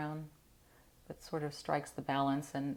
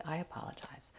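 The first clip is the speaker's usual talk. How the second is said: much softer, sleepy sorry; quiet sleepy apology; 8 dB quieter than usual. Real, not acted. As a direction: murmur a drowsy apology. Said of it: "I'm sounding sleepy".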